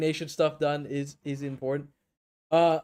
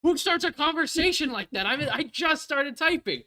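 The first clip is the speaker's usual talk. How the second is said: exasperated; 11 semitones higher, 7 dB louder than usual. Real, not acted. Defends himself in disbelief.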